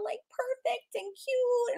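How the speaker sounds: high slow cutesy voice; mock sweet voice. Describing her sister's little girls in a cutesy voice. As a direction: coo it like something adorable.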